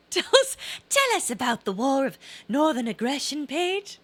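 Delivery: high, sing-song mock Southern plea. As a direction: tease her in a put-on Southern voice. Teasing mock southern request.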